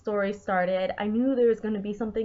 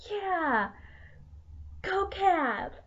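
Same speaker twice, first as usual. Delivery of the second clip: cheering; 8 semitones higher, much slower than usual. Retold or imitated. Cheering the character.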